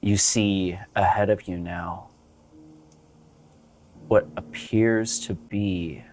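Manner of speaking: slow, low game master narration; scene narration. Acted. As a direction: set the scene slowly. GM scene setting over music.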